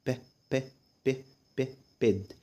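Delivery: "ب" as a short, soft beh, then "بيد"; short vowel drill. Shown as a demonstration. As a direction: sound out "ب" briefly, pause, repeat, blend into "بيد". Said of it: "بيد شورت فاول". ("ب" بصوت قصير ثم "بيد")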